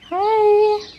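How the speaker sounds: very high, sweet hello; high cooing hi. Real, not acted. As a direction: coo a greeting. Greeting a chicken.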